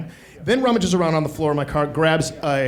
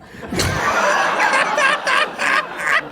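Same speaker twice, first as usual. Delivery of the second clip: high cackling laugh. Real, not acted. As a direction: cackle high and long, helpless. Laughing at the crack story.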